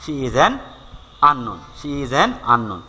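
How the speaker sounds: slow, deliberate reading of the example. Shown as a demonstration. Teacher reading an example to students, slowly. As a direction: spell it out slowly for the class. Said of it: "teacher reads example slowly".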